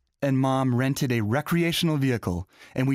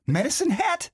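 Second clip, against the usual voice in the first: delighted; 13 semitones higher than usual. Acted. Delighted at quirky town name.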